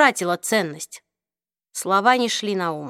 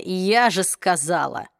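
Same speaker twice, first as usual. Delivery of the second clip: slow, lower mocking echo of "я же сказала"; mocking parrot; 3 semitones lower, slower than usual. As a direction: drawl "я же сказала" back at her, mocking. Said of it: "Ах, это вечное".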